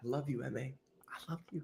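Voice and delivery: very hushed mock declaration of love; barely-there whispered affection; playful whisper. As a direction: whisper it fondly, half joking.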